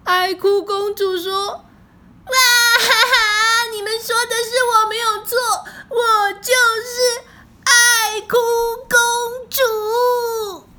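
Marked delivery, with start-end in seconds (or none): 1.5-10.7 high, loud theatrical bawling; wailing crybaby princess. A narrator's reading. Crybaby princess owns up to her friends, bawling loudly. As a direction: wail it out, stretching the last words into long sobs. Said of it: "她一哭起来".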